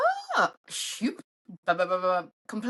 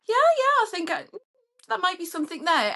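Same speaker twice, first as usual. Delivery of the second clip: very high, loud gushing yeah, then trailing off; mock eager match; 8 semitones higher, 3 dB louder, faster than usual. Imitating a match who swiped keenly, high and gushing. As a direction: play the keen match going yeah yeah. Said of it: "taken the time to go".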